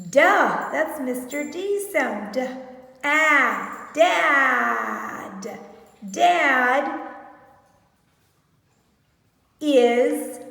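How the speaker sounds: duh said as a short loud stop sound; sounding out dad. Shown as a demonstration. Teacher sounding out the word dad for the class. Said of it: "what's the sound for this?".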